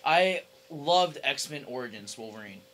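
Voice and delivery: usual speaking voice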